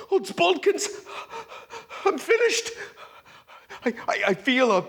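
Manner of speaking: high, strained cry of defeat; despair. Acted. Playwright panicking as his characters desert him, crying out high. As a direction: wail it like all is lost.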